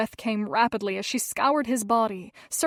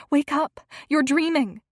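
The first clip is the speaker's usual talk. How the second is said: urgent call; 5 semitones higher, 7 dB louder than usual. A narrator's reading. Waking friend from nightmare.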